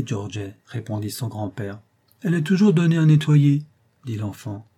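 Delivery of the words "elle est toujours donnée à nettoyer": put-on higher, louder child retort; a boy grumbling back; sulky little boy.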